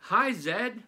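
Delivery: high, loud chorus greeting; class shout. Acted. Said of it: "the class shouted".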